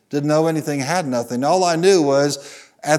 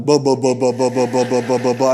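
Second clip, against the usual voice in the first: loud mock babble of a revving engine; hammed-up engine gibberish; car speaking in tongues; 4 dB louder than usual. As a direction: rattle it off like an engine sputtering. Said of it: "it spoke in tongue".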